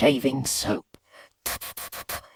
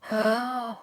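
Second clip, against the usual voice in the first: sharp high intake of breath; held breath; 6 semitones higher, 6 dB quieter than usual. Acted. Boy hiding behind the sofa, breath held. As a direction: gasp in and hold it. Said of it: "So I stop breathing".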